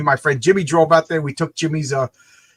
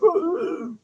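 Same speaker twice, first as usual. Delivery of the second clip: very high imitated ooh; high mock "ooh"; 13 semitones higher, 4 dB quieter than usual. Jimmy realises what he's eating, high mock ooh. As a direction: let out a high wordless reaction. Jimmy's wordless reaction to sandwich.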